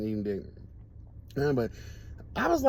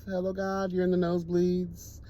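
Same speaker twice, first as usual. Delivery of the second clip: ordinary delivery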